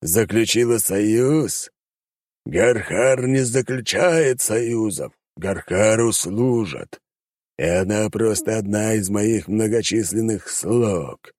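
high, slow demon voice, taunting; mocking demon. Demon mocks the hunter in his own domain, slow and sneering. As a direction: drag each word out like a scornful monster.

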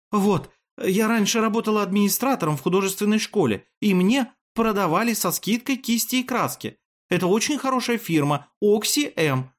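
very high-pitched, earnest character voice; apologetic woman character. A narrator's reading. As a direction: offer amends in a high, eager voice. Voices Efimia offering paints in apology. (высокий голос персонажа, извиняющийся тон)

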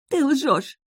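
high-pitched squealed accusation; shriek of disbelief. A narrator's reading. Witch doubting the heroine's motive, shriek of disbelief. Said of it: "взвизгнула женщина".

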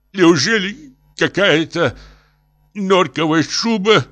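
slow, very high rhetorical plea; pleading professor. Acted. Professor dictates appeal about a coat, high lament. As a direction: declaim it woefully.

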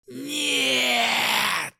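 high, piercing demonic howl; demon's scream. Acted. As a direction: shriek it in horror as a demon. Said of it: "вопль демона".

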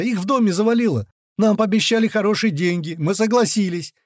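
high, hurried confession, words tumbling out; machine-gun gabble. A narrator's reading. Captive explaining himself to interrogators, machine-gun gabble. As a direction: pour it all out in a high panicky stream. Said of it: "проговорил со скоростью пулемёта".

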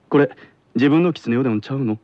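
brisk Kansai model question; Kansai dialect. Shown as a demonstration. Teacher repeating example for pupil, brisk Kansai dialect. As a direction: toss it off quickly in Kansai dialect. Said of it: "これを関西弁では".

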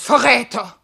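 loud, indignant cry; angry accusation. Acted. Wife accusing her husband, loud and indignant. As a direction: fling the word at him.

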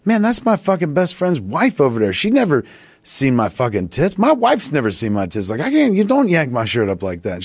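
high-pitched, flustered mock outrage; indignant protest. Patient scolds medic for yanking his shirt up, indignant. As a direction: protest high, retelling your own embarrassment.

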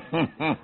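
short scornful laugh; scoffing laugh. Acted. Frank laughs off Willem's idea to keep calm, a scornful laugh. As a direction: laugh him off.

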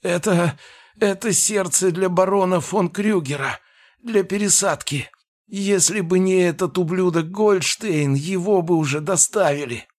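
high, frantic little gnome. Acted. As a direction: panicky high-pitched creature voice. Beaten gnome pleads, voiced by narrator.

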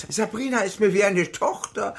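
lightly mock the late wife's shrill scold. Wolfram mimics his late wife, mock shrill scolding. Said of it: "pflegte Bernadette zu keifen".

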